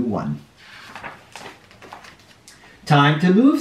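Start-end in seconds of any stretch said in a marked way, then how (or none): 2.9-3.6 brisk call to move on; eager crab voice. A narrator's reading. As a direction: perk up, a bit higher and louder. Crab's quoted line, story.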